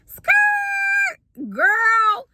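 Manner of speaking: high, loud, sassy squeal; sassy screech. Acted. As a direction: shriek it out like a diva. God's sudden interruption, voiced.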